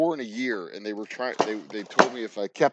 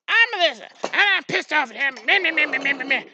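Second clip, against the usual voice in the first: much higher, louder mocking girl voice trailing into meh meh; mock angry girl; 7 dB louder than usual. The future wife introducing herself angry, mocked in a high squeaky voice. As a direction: caricature her ranting in a squeaky high voice. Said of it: "And she goes".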